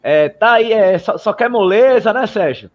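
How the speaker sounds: high, loud, slow teasing jeer; mock heckling. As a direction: rib him for taking it easy. Runners heckling him in tunnel.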